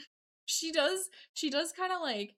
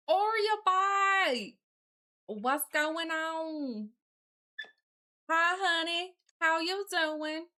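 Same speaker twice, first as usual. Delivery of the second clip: drawn-out, higher put-on Southern drawl; Southern accent; 4 semitones higher than usual. Streamer greeting honey in character, hammed-up Southern accent. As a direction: ham up a slow Southern accent. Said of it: "I can't do a Southern accent very well".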